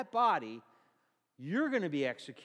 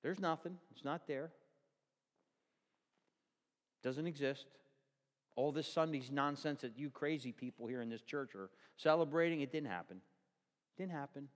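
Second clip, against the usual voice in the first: mock atheist; 4 semitones lower, 5 dB quieter than usual. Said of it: "The atheists go".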